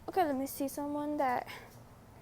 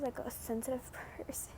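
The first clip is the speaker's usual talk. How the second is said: whisper; 8 dB quieter than usual. Real, not acted. Whispered self-description with laugh.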